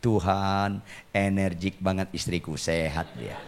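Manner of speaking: low, soft, resigned-sounding aside to God; silent inner prayer. Acted. Husband praying inwardly while his wife rages, hushed. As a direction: pray it privately, hushed and low. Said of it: "saya ngomong sama Tuhan dalam hati".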